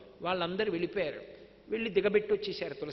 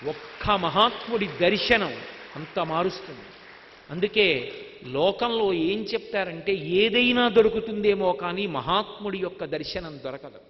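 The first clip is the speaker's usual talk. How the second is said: emphatic preaching; 5 dB louder than usual. Real, not acted. Preacher drives home the moral.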